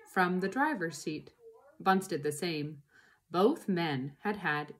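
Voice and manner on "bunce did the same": ordinary delivery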